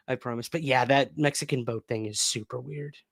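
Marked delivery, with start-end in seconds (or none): 0.0-0.5 soft laughing promise, much quieter. Real, not acted. Promising the chat with a soft laugh. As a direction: chuckle it out under your breath. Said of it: "laughs I promise".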